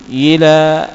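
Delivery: usual speaking voice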